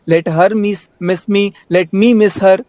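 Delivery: ordinary delivery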